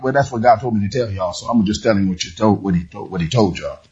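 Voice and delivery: low, quick, careless passing-on; mumbling it off like a bored messenger; offhand mumble. Retold or imitated. Preacher mocks a messenger mumbling carelessly. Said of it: "he wasn't saying it like".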